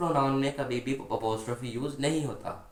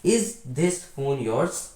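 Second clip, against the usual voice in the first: "is this phone yours?" drawn out word by word, very slowly; careful, very slow board reading; slow dictation; much slower than usual. Shown as a demonstration. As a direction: read the example question slowly so the class can copy it. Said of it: "next sentence".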